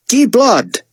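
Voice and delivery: "Guy" said "Gee" with a hard G; "Guy" as "Ghee", "Blood" rhyming with cod; French-style pronunciation. A narrator's reading. Artiste naming himself, "Guy" as French "Ghee", "Blood" as "Blod". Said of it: "pronounced G U Y as Guy with a hard G".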